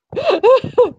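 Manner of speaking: high gleeful giggle; a squeaky delighted giggle. Acted. Fox giggles high while floofing Annika's hair.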